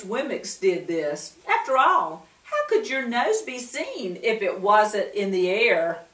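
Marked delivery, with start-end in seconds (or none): none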